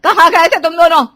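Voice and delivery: high, very loud demand; a frantic mother yelling at her children; scolding shout. Acted. Worried mother scolds returned children. (बच्चों पर चिल्लाती माँ)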